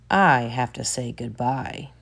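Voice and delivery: plain reading voice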